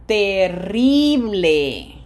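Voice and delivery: Terrible, drawn out, with a loud RRI; stress on second-last syllable. Shown as a demonstration. Teacher modelling for students, slow Terrible stressed on RRI. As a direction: stretch and punch RRI in Spanish Terrible. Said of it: "on the red syllable, syllable number two".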